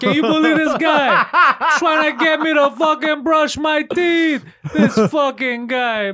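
hammed-up indignant rant at his buddy; mock outrage.

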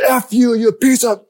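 high, loud shouted curse at grandma; angry teen yells at grandma through the door, high and loud; furious teen outburst. Retold or imitated. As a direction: explode at her like a stewing teen.

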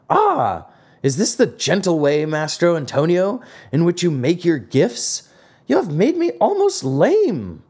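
high, indignant character voice; aggrieved complaint. A narrator's reading. Geppetto blames the carpenter for his sore legs, acted indignation. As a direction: protest in a high voice, rising to the end.

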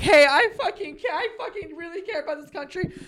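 high, fast mock protest breaking into a laugh; mocking an earnest poster. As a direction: parody an offended patriot, laughing. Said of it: "I don't want someone to be like".

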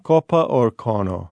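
slow, careful Italian "Coppa" and "cono"; Italian pronunciation. Shown as a demonstration. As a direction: spell out the Italian "Coppa or cono" slowly for the host. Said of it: "coppa, cono?".

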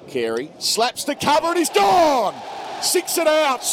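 high, loud shout of a wicket; excited wicket call. Real, not acted. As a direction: burst out as the catch is taken. Wicket falls, commentator erupts.

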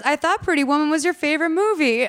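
high, put-on friend voice; high mock voice. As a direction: chirp it as a naive friend. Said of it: "She goes".

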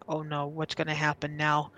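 quiet retold worry; subdued, understated dread; inner thought. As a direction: speak it softly, as if thinking to yourself. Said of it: "In my head, I'm like".

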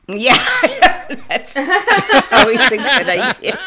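real laughter. Real, not acted. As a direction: agree while cracking up at the joke. Laughing at the unlock joke.

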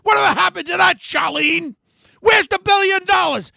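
indignant shouting question; angry shout. Real, not acted. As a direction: yell the accusation at her. Accusing opponent of stolen money.

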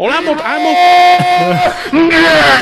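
high, loud stammering yell; yelled, stuck-on-a-word mock rant; random yelling. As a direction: imitate a wired man hollering from behind. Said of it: "just randomly yelling things".

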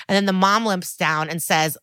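normal talking voice

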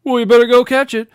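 loud, high punchline; punchline delivery. Pretend. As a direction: belt out the joke's punchline. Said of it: "the punchline is".

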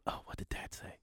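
a hushed panicked whisper to himself. Retold or imitated. Nervous son whispering to himself mid-date. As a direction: whisper it so the date can't hear. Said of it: "He says".

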